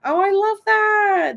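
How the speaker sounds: high, loud, drawn-out delight; slow high-pitched burst of enthusiasm. Real, not acted. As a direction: gush over it with a stretched squeal.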